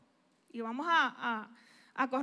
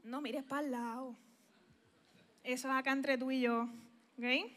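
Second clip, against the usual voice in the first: confiding aside; 4 semitones lower, 9 dB quieter than usual. Acted.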